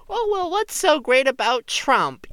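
high sarcastic voice; high snarky comeback voice; mock comeback. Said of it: "I could have been like".

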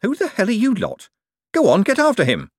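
high, indignant snap; loud, high outraged order; snapped. A narrator's reading. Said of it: "and then snapped".

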